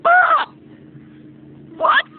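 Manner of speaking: high, loud shocked squeal; shocked shriek. Retold or imitated. Girl sees the boyfriend's ring, loud shocked shriek.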